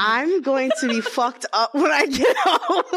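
high, slow, laughing through the dread; laughing mock panic. Telling the host about her mom finding flowers, laughing panic. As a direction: giggle out the fear of getting caught.

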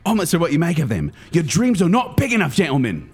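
put-on fast, lively Australian accent; Steve Irwin Australian. Comic doing an Australian Steve Irwin voice for guessers. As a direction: rattle it off like an excited Aussie wildlife host. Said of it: "I do the best Australian accent".

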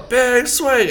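high yelled call; mock bar toast yell. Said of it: "And I yell".

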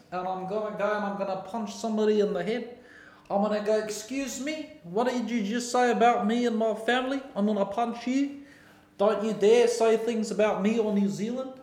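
lightly put-on New Zealand accent, mock tough; a mock-tough Kiwi in a playful threat; bad New Zealand accent. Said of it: "my New Zealand accent's pretty nice".

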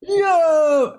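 excited yell. Real, not acted. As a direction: let out a thrilled, stretched shout. Excited reaction to reading ability.

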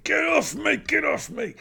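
high, urgent mock yell; imitating a panicked struggle; frantic retold cry. Bond retold fighting off attacker.

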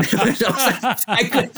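laughing. Real, not acted. Laughing at the memory.

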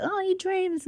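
high, soft mocking coo; mock-dreamy. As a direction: send up your own earnestness in a tiny high voice. Mocks own earnest-sounding talk.

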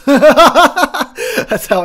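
slow, loud laughing admission. Real, not acted. Vlogger laughing at his own antisocial rant, slow and loud. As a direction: laugh it out at yourself.

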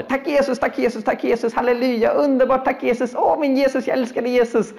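high, gushing thanks to Jesus; an overjoyed believer gushing thanks; ecstatic praise. Retold or imitated. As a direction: pour out delighted praise, high and quick. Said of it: "när det händer något som hon är glad för".

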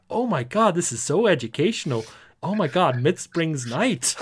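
high and loud, gushing over the discovery; mock amazed Americans. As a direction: ham up the wide-eyed American flatmates. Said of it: "They were just like".

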